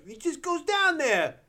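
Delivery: put-on high, drawn-out child voice; imitated little kid. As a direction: play a kid stating the obvious, slowly. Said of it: "one kid's like".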